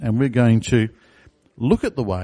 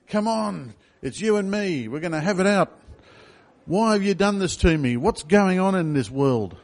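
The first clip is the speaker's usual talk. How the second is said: retold yelling at God; 7 semitones higher than usual. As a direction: imitate a man shouting at God from the rigging, understated. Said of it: "yelling and screaming at God".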